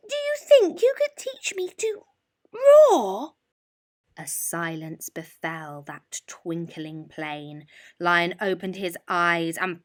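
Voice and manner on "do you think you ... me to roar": very high, trembling mouse with squeaks; nervous squeaky mouse. A narrator's reading. Mouse nervously waking the lion to ask for roar lessons. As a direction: stammer it out, scared stiff.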